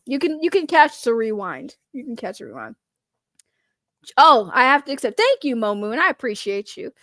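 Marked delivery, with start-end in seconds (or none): 1.9-2.7 softer, under the breath; quiet repeat. Real, not acted. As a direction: say it again quietly to yourself. Repeating reassurance to viewer Isaac.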